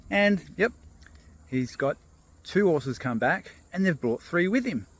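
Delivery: ordinary delivery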